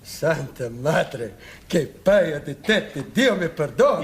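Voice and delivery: Italian exclamation. Acted. Milkman gasping in Italian at Fletcher's picture. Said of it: "I don't understand the language".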